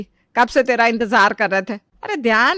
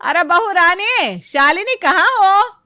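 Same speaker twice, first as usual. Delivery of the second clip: higher, slow drawn-out call; calling loudly; 7 semitones higher, slower than usual. Acted. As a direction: call her louder, stretching each word. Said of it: "फिर से जोर से आवाज लगाती है". (ज़ोर से धीमी लंबी पुकार)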